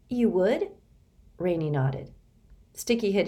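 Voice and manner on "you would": hopeful surprise. Acted. Sticky surprised.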